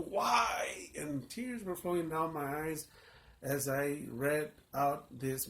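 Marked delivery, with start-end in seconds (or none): 0.1-0.9 high, loud anguished cry; retold tearful shout of why; yelling why. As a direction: wail the question at God. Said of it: "I was yelling".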